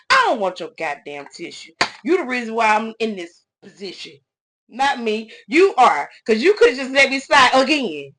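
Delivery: tearing into the man who fired her; building retold rant, ending in a near shout; angry outburst. Retold outburst at boss over tissue.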